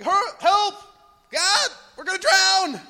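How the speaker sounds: high, loud mock panic shout; panicked cry for help. Believers crying to God in the storm, loud mock panic. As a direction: scream for help as the boat sinks. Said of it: "panic mode".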